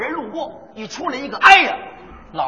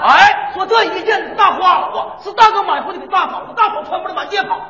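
higher, chirpy hawker's chant; sing-song Dongbei vendor pitch; Northeastern hawking; 6 semitones higher than usual. Acted. Performer shows his partner a Northeastern vendor's call. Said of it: "东北故意".

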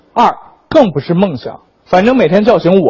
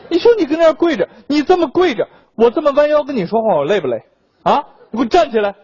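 mock-annoyed, higher-pitched rant; exasperated scolding; 7 semitones higher than usual. Employee telling his kneeling boss to get up, in acted exasperation. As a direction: scold him with acted impatience.

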